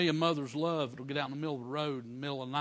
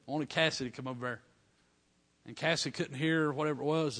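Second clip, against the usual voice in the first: ordinary talk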